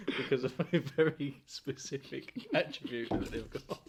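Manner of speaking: drawn-out words broken by laughing; laughing through it. Real, not acted. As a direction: struggle to finish through laughter. Cracking up at own racism joke.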